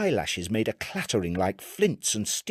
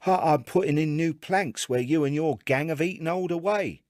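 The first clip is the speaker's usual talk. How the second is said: Chips answering; 8 semitones higher than usual. A narrator's reading. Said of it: "said Chips".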